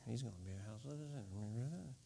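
a grumbling aside under the breath; muttering. Shown as a demonstration. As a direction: mutter it out of the side of your mouth. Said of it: "A mutter is like".

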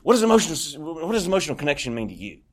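rushed question, tripping over the start; retold eager, hurried question; impatient blurting. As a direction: blurt it out fast without waiting. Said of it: "I just didn't even wait".

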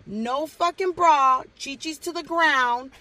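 loud, high-pitched acted ultimatum; threatening tirade. Guardian threatening brother at school drop-off, loud acted tirade. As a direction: lay down the law at full volume, drawing out each threat.

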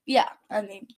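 talking around ice; words round a cold ice cube; ice in mouth. Real, not acted. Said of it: "Sorry, ice in my mouth".

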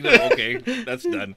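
lightly voiced shopper; mock dismissal. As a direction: brush it off like nothing happened.

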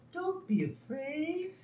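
high, soft, slow alien; very gentle voice. Retold or imitated. Glowing being soothes frightened Homer, very gentle high voice. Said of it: "in a very gentle voice".

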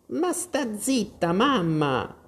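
high, drawn-out peeved daughter; a bit peeved. A narrator's reading. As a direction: squeal back at your mother, annoyed. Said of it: "un po' stizzita". (figlia stizzita, voce acuta)

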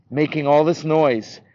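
ordinary delivery

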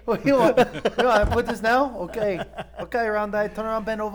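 mock delivery guy. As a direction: boss the customer around in a squeaky voice.

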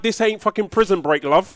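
indignant retort. Retold or imitated. Reacting to the razor blade show, loud indignant. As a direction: snap back in disbelief.

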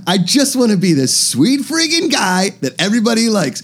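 put-on high, wistful wannabe cool kid; eager put-on boy voice; Kim Jong-un. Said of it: "Kim Jong-un wants to go to".

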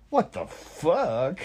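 high, slow mock exclamation; break from regular voice. Showing a buddy's outburst mid-story, high and drawn-out. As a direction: jump up out of normal storytelling and stretch it. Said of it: "would tell a regular story at regular voice".

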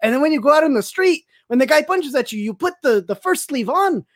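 put-on a light Mexican accent, pitched a bit up; playful spoof of a Mexican martial arts teacher's accent. Retelling a spoof judo lesson to his co-host in a light Mexican accent. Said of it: "with the accent you're giving".

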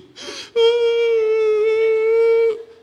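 long, loud mock prophetic scream; high-pitched scream. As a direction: let out a piercing, sustained high scream. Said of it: "with a high-pitched scream".

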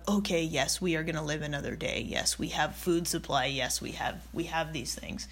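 low, hushed self-talk; quiet self-reassurance. Acted. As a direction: reassure yourself under your breath, low and soft. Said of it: "it's that feeling of".

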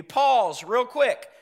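usual speaking voice

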